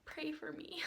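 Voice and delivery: sigh, then a hushed whisper; whispered plea. Retold or imitated. Customer dreading a horrible makeup appointment, whispered plea.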